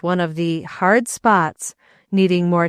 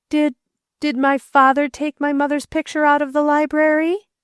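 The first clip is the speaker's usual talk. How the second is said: put-on boy's voice, higher, a touch of anger; hurt boy demanding the truth; angry boy; 8 semitones higher than usual. A narrator's reading. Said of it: "his eyes glowed with something like anger".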